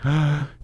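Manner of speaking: sharp acted intake of breath; sudden gasp. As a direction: gasp as if realizing a mistake. Acts arriving and regretting it.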